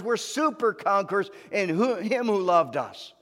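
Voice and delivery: loud, high preaching build; fiery preaching. Acted. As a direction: preach it to the rafters. Preaching at full force.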